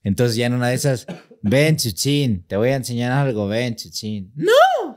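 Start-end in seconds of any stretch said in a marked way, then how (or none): 1.4-4.3 slow drawn-out coaxing; ominously gentle mom call; mock sweet luring. Mother luring child sweetly. 4.3-5.0 put-on high screaming kid's no; piercing child cry; child's scream.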